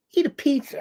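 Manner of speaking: scoffing high-pitched repeat; incredulous. Shown as a demonstration. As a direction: say Eat a pizza like it's a ridiculous feat.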